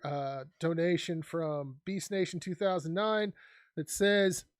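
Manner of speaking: normal talking voice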